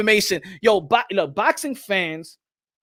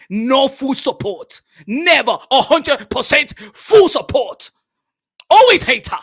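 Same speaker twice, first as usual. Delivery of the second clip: mock outrage; 5 semitones higher, 6 dB louder than usual. Streamer scolding the haters in his chat, mock outrage. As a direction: build to a high, loud cry of grievance.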